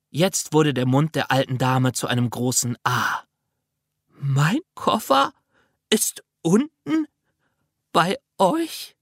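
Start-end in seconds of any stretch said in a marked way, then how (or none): 4.1-9.0 put-on amazed old lady.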